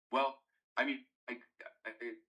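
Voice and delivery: breaking up on the line; words dropping out, bad connection; choppy connection. Real, not acted. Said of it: "Tim, you're breaking up a little bit".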